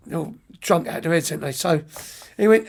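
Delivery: ordinary delivery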